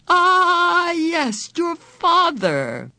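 high, loud delighted chortle sliding down; chortling with delight. A narrator's reading. Man on the chair gloats over Meg's father.

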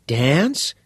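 put-on bright, curious teenage boy; a keen schoolboy pressing for details; eager boy voice. Todd asking.

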